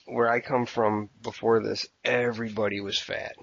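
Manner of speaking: ordinary delivery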